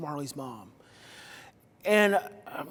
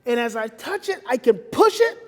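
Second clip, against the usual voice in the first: ordinary delivery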